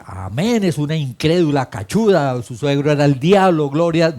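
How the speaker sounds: building to a loud mock tirade; mock outraged rant. Pastor mocks a harsh reply to the jilted disciple, loud. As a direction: ham up a preacher's scornful outburst.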